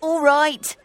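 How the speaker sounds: high character voice, moderately lifted; Danny. A narrator's reading. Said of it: "said Danny".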